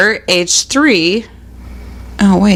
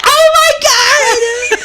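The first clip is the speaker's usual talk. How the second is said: very high mock shriek, drawn out; hammed-up horror, half laughing; horrified kids; much slower than usual. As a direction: squeal it like an appalled child. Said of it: "they're like".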